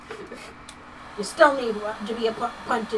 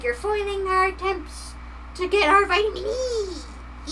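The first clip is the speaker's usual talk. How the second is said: gremlin voice; 9 semitones higher than usual. Pretend. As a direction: whine it like a foiled little gremlin. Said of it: "they're like".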